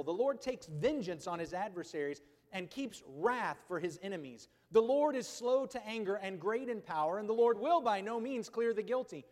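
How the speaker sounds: a preacher thundering Nahum at the congregation; forceful preaching. Acted. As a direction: read God's wrath like a storm breaking. Loud reading of Nahum.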